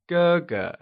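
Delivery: "ge ge" with a high, level first syllable. Shown as a demonstration. Teacher models the word for elder brother, "ge ge" in high level tone. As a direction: hold the first half of "ge ge" high and even. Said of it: "pronounced using the high level tone".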